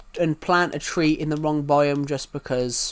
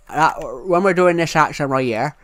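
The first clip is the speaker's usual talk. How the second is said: a louder, lightly thickened regional UK accent; thick UK accent; 4 dB louder than usual. Shown as a demonstration. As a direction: lean into his own UK accent, thicker. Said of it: "that is actually what my accent sounds like if it's actually really thick".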